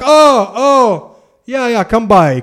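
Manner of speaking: imitated high, loud 'oh' of remembering; sudden recognition. Ambassador remembering the caller and inviting him, mildly surprised mimicry. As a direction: start high and loud, then ease down.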